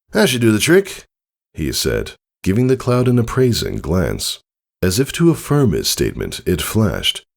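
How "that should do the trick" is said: raised, brighter fatherly voice. A narrator's reading. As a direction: lift it into a proud dad. Father speaks.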